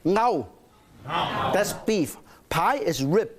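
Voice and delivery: normal talking voice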